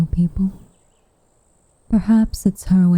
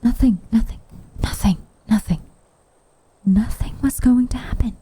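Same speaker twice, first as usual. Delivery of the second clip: breathy hurry-along whisper, slightly higher; flustered hush; 5 semitones higher than usual. Acted. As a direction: wave her off in a hushed whisper. Caught out, hushes the girl.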